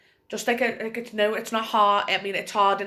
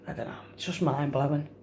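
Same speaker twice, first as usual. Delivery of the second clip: breaking; 5 semitones lower, 4 dB quieter than usual. Real, not acted. Breaking down over cancer.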